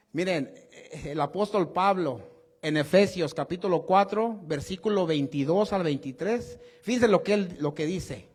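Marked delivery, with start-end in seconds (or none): none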